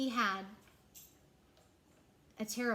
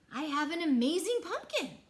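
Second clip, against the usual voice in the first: higher, louder proud announcement; 6 semitones higher, 6 dB louder than usual. A narrator's reading. Clayton boasting alone to himself in the garden, loud and proud. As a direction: proclaim it to nobody in particular. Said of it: "he said out loud".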